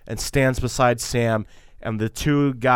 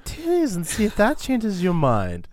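high seductive voice; 9 semitones higher than usual. Retold or imitated.

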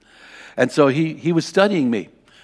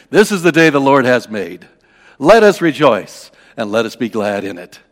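ringing, much louder public quoting; chapel proclamation; 11 dB louder than usual. Retold or imitated. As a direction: declaim it loudly like a verse from the pulpit. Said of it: "stand up in chapel and quote from the verse".

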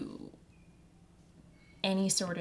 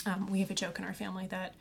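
ordinary delivery

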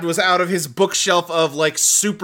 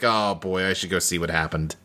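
much lower and softer, mock dopey; dopey cop; 10 semitones lower, 5 dB quieter than usual. Lazy cop heading off to look, low soft dopey mock voice. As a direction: drop way down, mock oblivious. Mocking the cop's lazy attitude.

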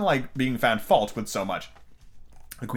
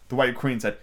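plain reading voice

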